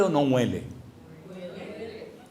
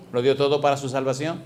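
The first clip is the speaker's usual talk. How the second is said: normal talking voice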